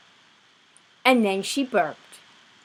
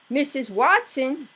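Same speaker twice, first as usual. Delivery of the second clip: calling out; 8 semitones higher, 5 dB louder than usual. Acted. Husband calls his wife.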